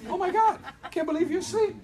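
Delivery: playful wake-up call, laughing through it; cheerful caller laughing at a sleepy friend; teasing laugh. Retold or imitated. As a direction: tease the sleepy prospect with a giggle. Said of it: "And I'd be like".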